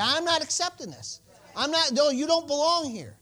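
loud, high and firm rebuke; rebuking addiction with full force; bold declaration. Shown as a demonstration. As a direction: command the addiction out. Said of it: "Start speaking to it".

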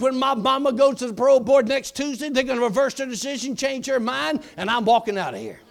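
loud, high preaching at the gate; commanding proclamation. Retold or imitated. Prisoner prophesying to the prison gate, loud and commanding.

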